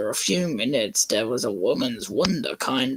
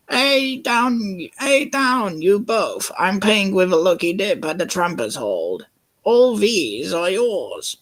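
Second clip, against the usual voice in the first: higher, louder character call; 8 semitones higher, 3 dB louder than usual. Acted. Cranky crane calling to Percy and Oliver, higher voice. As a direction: call out to the two engines.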